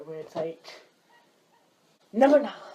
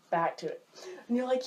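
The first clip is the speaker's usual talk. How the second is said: usual speaking voice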